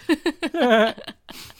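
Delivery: high wheezy cartoon-dad laugh, hammed up; Peter Griffin laugh. Retelling the pig joke, breaks into a high Peter Griffin laugh. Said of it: "I laughed like Peter Griffin".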